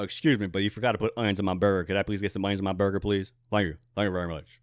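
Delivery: [name]. Acted. Customer asking In-N-Out for forgotten onions, [name] impersonation. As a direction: play the singer politely ordering, voice dropped. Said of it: "give me a line to say an [name]".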